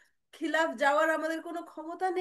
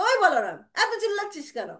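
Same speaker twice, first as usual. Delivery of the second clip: loud, sharp rebuke; scolding mother; 6 semitones higher, 7 dB louder than usual. Acted. Mother asking Balaram why he yells, loud scolding. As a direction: cut off the noisy boy.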